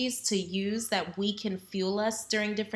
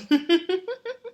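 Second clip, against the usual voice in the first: high giggle; 11 semitones higher, 6 dB louder than usual. Real, not acted. Laughs after water remark.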